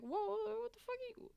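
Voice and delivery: very high retold squeal of alarm; startled. Patient reacting to being thrown out, startled. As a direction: back off in high-voiced bewilderment. Retold shock at surgeon's yelling.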